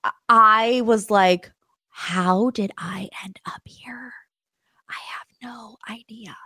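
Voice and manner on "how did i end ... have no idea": slow, soft whisper; awed whisper. Retold or imitated. [name] amazed in the VIP room, soft whisper. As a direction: whisper in disbelief.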